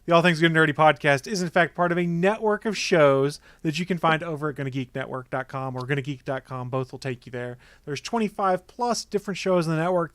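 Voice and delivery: upbeat promo announcer voice; ad read. Acted. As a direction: sell the network, bright and loud. Podcast network promo read.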